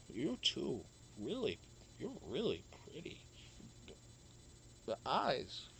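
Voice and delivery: soft, low, dreamy slur; drugged quiet slur. Retold or imitated. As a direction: mumble it, dazed and soft. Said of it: "and I go".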